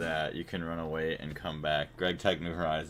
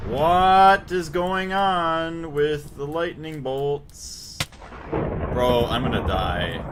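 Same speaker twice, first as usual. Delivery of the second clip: panicking gamer; 10 semitones higher, 9 dB louder, much slower than usual. Real, not acted. Boss fight, about to die.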